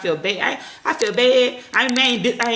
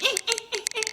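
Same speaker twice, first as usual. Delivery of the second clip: put-on high, whiny fussing toddler noises; imitating toddler whine; 6 dB quieter, faster than usual. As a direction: mimic a fussy baby squirming.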